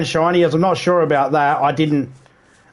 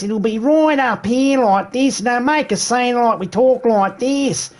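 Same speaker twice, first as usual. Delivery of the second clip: high, squeezed mock Aussie accent; bad Australian accent; 9 semitones higher than usual. Shown as a demonstration. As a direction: exaggerate a cartoon Australian, pitched way up. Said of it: "will normally sound like this".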